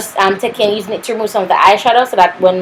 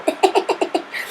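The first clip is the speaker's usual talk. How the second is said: high, soft giggle; 9 semitones higher, 9 dB quieter than usual. Real, not acted. Vlogger tickled by her own smile screenshot, giggling.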